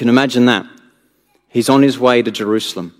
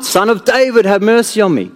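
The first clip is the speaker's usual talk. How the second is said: higher, urgent plea; crying louder; 7 semitones higher than usual. Acted. Beggar crying out over the crowd, pleading. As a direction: keep calling out over them. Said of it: "cry even louder".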